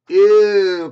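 loud high grossed-out ew; a kid's loud yucky ew; disgusted ew. Acted. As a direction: spit out the taste, loud and high. Clifford tastes thermometer.

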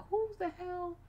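high, startled question; mock-shocked high voice; retold surprised exclaim. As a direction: squeak out your own shock. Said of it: "I said".

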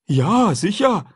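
a simple, eager man talking food; runaway man chatting with the boy; Jim's voice. A narrator's reading.

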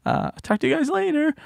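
bow out gently in a high voice; a hint of high grandma voice; imitated understanding grandma. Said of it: "She's like".

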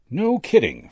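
high, loud show of admiration; admiring exclamation. A narrator's reading. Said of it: "exclaimed in the right tone of admiration".

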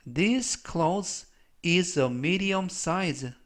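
slow, measured English for learners; slow model reading. Shown as a demonstration. Teacher reading an English example for learners, slow model reading. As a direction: read it out slowly as a pronunciation model.

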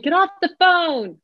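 put-on high, sharp order to a kid; nagging parent. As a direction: bark it like a fed-up mom. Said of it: "tell your kid".

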